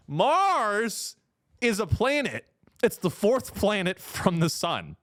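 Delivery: high, drawn-out first word, then a pause; exasperated, patronizing emphasis; condescending explainer. Real, not acted. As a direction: spell it out for slow pupils. Repeats it as to schoolchildren.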